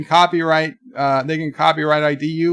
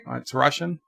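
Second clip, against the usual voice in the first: normal talking voice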